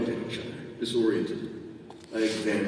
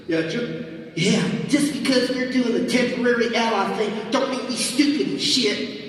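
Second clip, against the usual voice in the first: high, sassy character voice; 11 semitones higher, 4 dB louder than usual. Acted. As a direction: snark it back. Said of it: "Rapture added".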